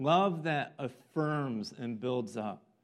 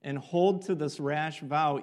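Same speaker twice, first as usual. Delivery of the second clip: usual speaking voice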